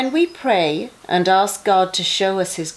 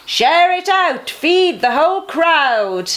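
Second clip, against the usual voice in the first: raised, higher call to the crowd; calling out loud; 9 semitones higher, 4 dB louder than usual. Acted. Jesus calls to the hungry crowd to share food, loud and high. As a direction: lift it up and out to the crowd. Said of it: "called out loud".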